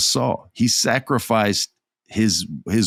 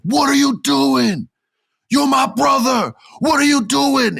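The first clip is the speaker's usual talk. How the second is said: retold shout, much higher and louder; shocked shouting; 3 dB louder than usual. Jey confronts his brother Sami.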